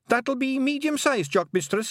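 a tiny dutiful Feagle, proud and earnest; eager pixie. A narrator's reading. Feagle corrects Tiffany.

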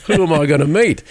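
laughing. Real, not acted. Amused at the coincidence.

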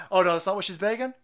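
ordinary talk, nothing marked

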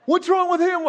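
high-pitched mock alarm; hammed-up bewildered cry; retold alarmed question. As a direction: imitate a startled onlooker, voice jumping up. Acts out alarmed reaction to collapse.

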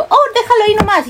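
high, fast, breezy offhand remark; casual blurt. Retold or imitated. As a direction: toss it off like to family. Blurting Spanish to supervisor. (soltarlo alegre y rápido, agudo)

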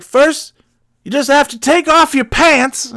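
high, drawn-up trucker voice; imitated trucker. Trucker making an imagined demand, imitated trucker.

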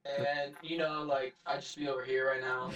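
soft, distant mock voice; sounding far away. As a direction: pretend to be far off. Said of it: "I gotta make it sound like he's from far away".

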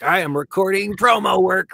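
proud mock voice actor; mock voice actor announcing. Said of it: "and they're like".

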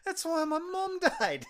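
high, loud, drawn-out wail; hammed-up slow yell; mock anguished cry. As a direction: wail it out slowly, imitating a distraught son. Imitating the son's outcry.

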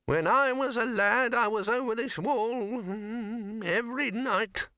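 put-on high, quavering old wizard, put out; a cranky ancient wizard grumbling about his youth; resentful old man. Old Poons resentfully boasting to fellow wizards, high creaky voice. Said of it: "said Poons resentfully".

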